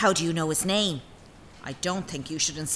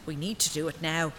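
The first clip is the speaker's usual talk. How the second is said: ordinary talk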